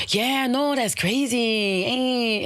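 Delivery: high sing-song mock Spanish accent; put-on Spanish accent. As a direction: imitate someone slipping into a Spanish accent. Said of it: "they take on that motherfucker's accent".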